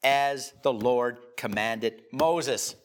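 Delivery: As the Lord commanded Moses drawn out slowly, each word weighted; slow emphatic phrase. Shown as a demonstration. Preacher reading the repeated refrain to the church, slow and weighted. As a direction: lay it down slowly like scripture. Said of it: "Look at the end of verse seven".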